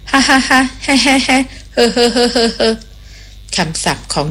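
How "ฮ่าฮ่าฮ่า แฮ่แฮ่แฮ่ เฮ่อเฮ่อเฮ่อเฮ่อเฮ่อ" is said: put-on high, cackling old witch laugh; witch's cackling laugh. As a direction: cackle till the tears run, much higher. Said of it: "ก็หัวเราะออกมาด้วยความขบขันเสียงดังกักกัก". (ยายแม่มดหัวเราะกักกักเสียงสูงลั่น)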